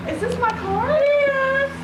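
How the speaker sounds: gasping joy at a new car; thrilled surprise. Real, not acted. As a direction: sister sees her new car and gasps with delight. Sister sees the new car.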